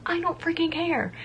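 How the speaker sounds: lightly acted carefree defiance; defiant. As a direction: toss it off defiantly, pitch way up. Retold words to her team.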